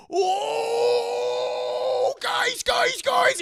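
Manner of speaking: very high, loud panicked yell; panicked shout. Retold or imitated. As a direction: call everyone in a panic. Guy freaks out calling frat.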